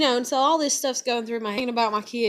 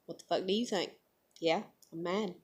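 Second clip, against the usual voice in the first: deadpan; 3 semitones lower, 8 dB quieter than usual. Retold or imitated. Imitates her own mad look.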